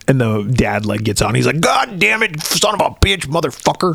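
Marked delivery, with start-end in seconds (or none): 1.6-3.9 high, exasperated cursing run; dad stuck in the mud swearing, in a higher exasperated voice; mock frustrated cursing. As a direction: curse it like a fed-up dad.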